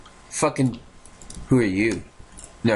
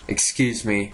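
ordinary delivery

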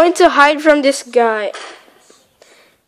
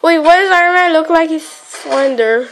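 ordinary delivery

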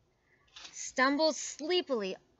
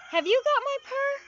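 much higher, louder question; 6 dB louder than usual. A narrator's reading. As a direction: ask it in a high kitten voice. Kitten asks the ducks.

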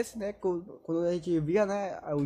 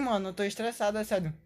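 ordinary talk, nothing marked

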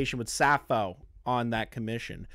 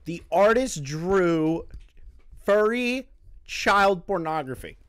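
raised voice, each phrase landed hard; pointedly spelling it out; 5 semitones higher, 4 dB louder than usual. Shown as a demonstration. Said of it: "I will say it. Watch me".

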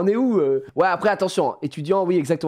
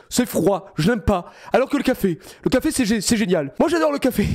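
mock prim student; 8 semitones higher than usual. Parrots the student's prim answers.

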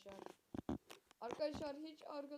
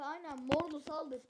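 ordinary delivery

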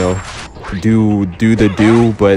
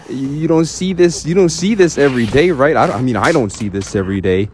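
normal talking voice

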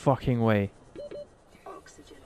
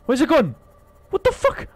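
high, loud alarmed yell; startled shout; 12 semitones higher, 5 dB louder than usual. Real, not acted. Gamer losing sight of the monster, startled yell. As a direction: shriek in sudden alarm. Creature vanishes suddenly.

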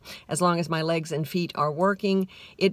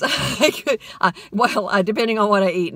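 laughing; 3 semitones higher, 5 dB louder than usual. Real, not acted. Laughing at own gas pun.

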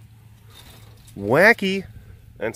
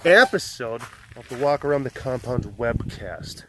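upbeat host welcome; show host intro; 3 semitones higher than usual. Acted. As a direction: announce the episode brightly. Webcast intro announcer.

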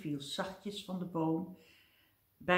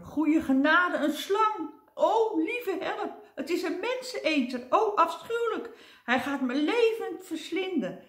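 very high, loud panicked cries; timid man mistaking a worm for a man-eater, much higher; terrified wail; 11 semitones higher, 4 dB louder than usual. Acted. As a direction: shriek in horror at the snake you imagine. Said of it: "schrok zich een ongeluk".

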